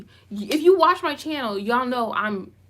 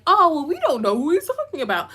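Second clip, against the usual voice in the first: high, swooping fake-clueless voice; mock innocence; 4 semitones higher than usual. Everybody acting like they don't know, mockingly. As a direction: play dumb in a mocking higher voice. Mimicking people feigning ignorance.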